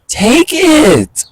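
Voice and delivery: high pleading shout; urging shout. Real, not acted. At the doves ignoring seeds, high pleading shout. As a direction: squeal it at the birds, pleading.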